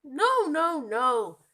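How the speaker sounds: high, loud no no no; loud protest. Acted. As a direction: shout the denial. Monkey rejects parrot.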